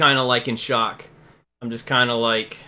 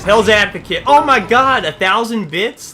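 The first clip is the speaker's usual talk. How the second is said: excited; 6 semitones higher, 6 dB louder than usual. Real, not acted. Thrilled by a big donation.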